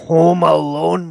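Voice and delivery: drawn-out loud title call; loud slow announcer. Retold or imitated. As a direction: boom it like a trailer.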